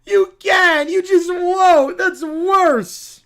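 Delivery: put-on loud, slow crying kid whine; bratty child. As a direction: wail like a spoiled child. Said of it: "the Brat Pack".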